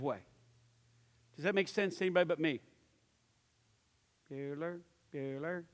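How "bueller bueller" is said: low, quiet monotone teacher; droning roll call. Acted. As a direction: call a name into a silent room. Movie roll-call quote to silent audience.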